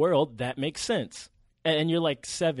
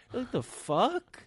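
high, slow stunned "the fuck"; shocked disbelief; 10 semitones higher, much slower than usual. Retold or imitated. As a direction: drag it out in disbelief. Shocked at finding out.

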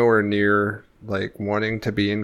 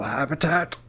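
nature documentary narrator; 3 semitones higher, 4 dB quieter than usual. Acted. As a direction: narrate solemnly as if observing wildlife.

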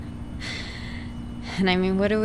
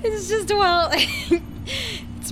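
high, slow laugh-crying; unhinged laugh; 12 semitones higher, much slower than usual. Acted. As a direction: laugh as if breaking down. Character breaks, laughing crying.

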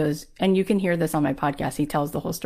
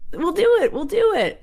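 high, louder burst of determination; eager insistence; 13 semitones higher, 4 dB louder than usual. Retold or imitated. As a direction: act out brushing off every no. Undaunted producer insists after refusals.

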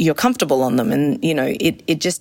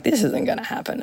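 quiet doubt; 7 semitones higher, 4 dB quieter than usual. Retold or imitated. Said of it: "I was like".

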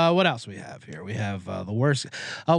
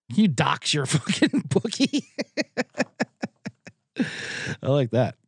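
laughing through words. Real, not acted. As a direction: crack yourself up mid-question. Cracking up at his own joke.

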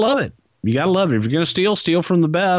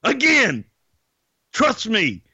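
high-pitched, hollering champ bravado; strutting wrestler boast, pitched way up; wrestling promo; 12 semitones higher than usual. Acted. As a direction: crow it high, stretching the phrase endings. Mock Ric Flair wrestling promo.

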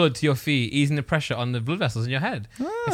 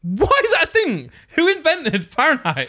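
much higher, incredulous outburst; exasperated outburst; 12 semitones higher than usual. Real, not acted. Ranting to friends about the Fahrenheit scale, exasperated. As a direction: blurt it out high in exasperation.

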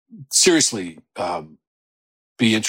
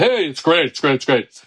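hearty backslapping praise, pitch lifted; quick repeated mock cheering; mock congratulations; 5 semitones higher than usual. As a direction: gush it like empty congratulations. Acted hollow backslapping congratulations.

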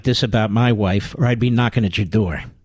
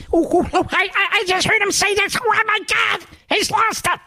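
much higher, squeaky mock panic; mocking whiny staffer. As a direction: ham up a shrill, panicky young staffer gasping at the radio. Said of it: "with their prepubescent Neanderthals there".

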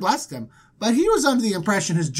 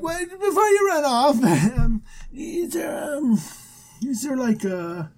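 high opening, then halting stammer; sheepish interruption; 5 semitones higher than usual. Retold or imitated. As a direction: hedge awkwardly, play-acting. Abram sheepishly stops God.

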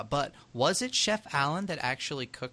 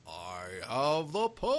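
Cam Clark; 5 dB quieter than usual. Retold or imitated. Host mocking the voice actor's He-Man, operatic. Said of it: "Cam Clark was the whole, like".

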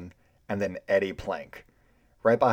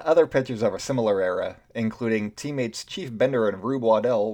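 usual speaking voice

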